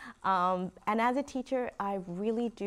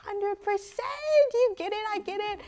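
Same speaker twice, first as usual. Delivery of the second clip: very high, excited retelling; delighted agreement; 13 semitones higher, 3 dB louder than usual. Retold or imitated. Teacher retelling agreeing with her student, delighted. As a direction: squeal the agreement, stretching the first words.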